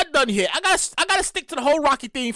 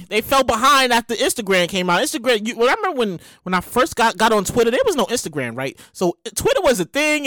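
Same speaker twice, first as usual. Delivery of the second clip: ordinary delivery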